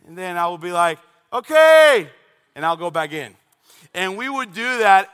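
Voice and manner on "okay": high, loud, drawn-out holler; yelled reply. Retold or imitated. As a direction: sign off with a big shout. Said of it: "I would scream at Adrian".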